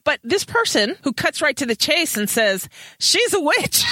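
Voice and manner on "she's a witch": much higher blurt, then a laugh; blurted mock accusation. Kit blurting out to Matthew, high mock accusation. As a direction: blurt it out, pitched way up.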